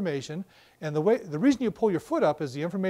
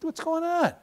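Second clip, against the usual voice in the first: high, slow puzzled voice; confused, drawing it out; baffled inner voice, pitched way up; 10 semitones higher than usual. Retold or imitated. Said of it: "and you're thinking".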